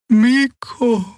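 müde, hoch und fremd gesprochen; müde alte Draxyl. A narrator's reading. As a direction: schwach den Namen erkennen. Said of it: "erkannte sie müde". (müde alte Heilerin)

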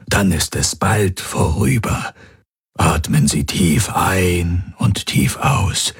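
slow, soothing doctor voice; calm reassuring. Acted. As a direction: guide her breathing slowly and gently. Healer guides breathing in labor.

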